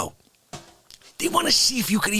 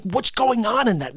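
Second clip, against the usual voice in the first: usual speaking voice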